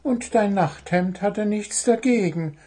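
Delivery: high-voiced bird justifying itself; innocent, high-pitched self-defence; defensive little bird. A narrator's reading. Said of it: "verteidigte sich Zwitschi".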